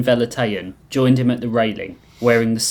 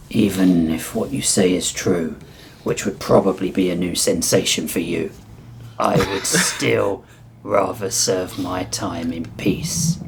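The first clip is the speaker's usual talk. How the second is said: low, slow, restrained reptile voice; stern reptile prisoner; 4 semitones lower than usual. Acted. Reptile inmate rebuffing mob boss after settling himself, low and restrained. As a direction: refuse with low, deliberate restraint, slowing toward the end.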